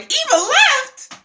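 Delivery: hammed-up high, loud shriek; imitating a whole table's stunned outcry; shocked disbelief. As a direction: squeal it slowly in mock shock. Acting the table's shocked reaction.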